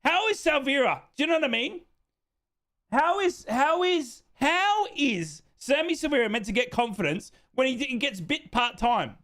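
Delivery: high-pitched, incredulous protest; indignant protest. Real, not acted. Streamer defends Severa to critical fans, indignant protest.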